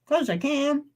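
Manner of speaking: talking through a mouthful; chewing while talking; mouth full. Real, not acted. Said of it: "I'm gonna talk with my mouth full".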